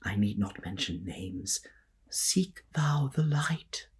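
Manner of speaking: soft, unvoiced hushed aside; discreet whisper. Lister whispers discreetly about their friend. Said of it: "says discreetly".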